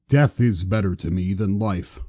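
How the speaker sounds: low, solemn Hoborg voice; deep voice. A narrator's reading. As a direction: speak low and heavy like a giant. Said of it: "in his ever-so-deep voice".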